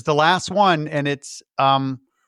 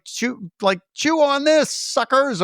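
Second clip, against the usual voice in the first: high-pitched mock taunt; imitating Bert; 10 semitones higher, slower than usual. Acting out Bert hurling a bomb at critters, mock taunt.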